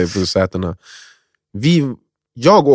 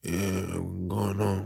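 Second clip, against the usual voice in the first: put-on very soft, slow drunken mumble; barely awake drunk passenger; retold drunk slur; 3 semitones lower, 15 dB quieter, much slower than usual. Too drunk, climbing into a taxi to go home, drunken mumble. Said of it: "jag var för full".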